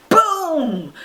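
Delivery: long falling "boom", pitched high; booming sound effect. Acted. Telling children the bright light suddenly appears, dramatic boom.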